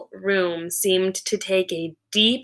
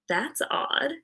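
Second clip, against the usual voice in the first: thought to herself; 3 semitones higher, 9 dB quieter than usual. A narrator's reading. Principal's private thought.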